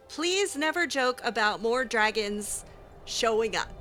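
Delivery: normal talking voice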